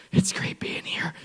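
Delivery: airy whisper of a sunburned tourist; mocking sweaty Chicago tourists. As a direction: breathe it out, wilting in the heat. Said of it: "they're like".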